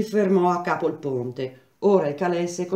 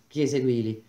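ordinary delivery, nothing marked

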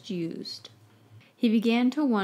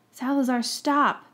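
yelled warning; 5 semitones higher, 4 dB louder than usual. A narrator's reading. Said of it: "Harry heard Snape yell".

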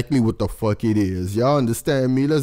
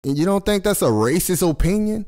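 usual speaking voice

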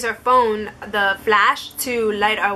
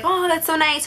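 polite mild reaction; 7 semitones higher than usual. Retold or imitated. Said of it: "Like, I'll say".

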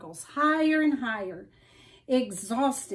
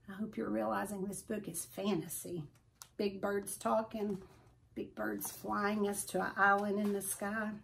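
much softer wry aside to listeners; quiet aside; 2 semitones lower, 10 dB quieter than usual. Real, not acted. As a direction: lean in and confide with a dry smirk.

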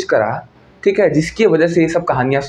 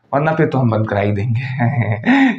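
low, slow smug warning; low slow threat; 3 semitones lower, slower than usual. Acted. Vlogger slowly warns Pakistan with a low smug threat. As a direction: lean in and threaten calmly.

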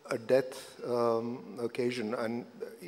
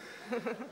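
light laugh; 10 semitones higher, 8 dB quieter than usual. Real, not acted. After a funny anecdote.

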